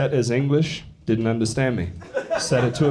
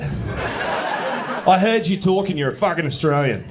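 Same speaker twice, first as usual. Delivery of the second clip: retold indignant Australian; 5 semitones higher, slower than usual. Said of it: "I've had Aussies come up to me".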